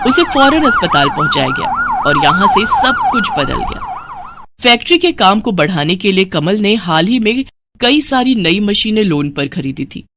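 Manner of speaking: ordinary delivery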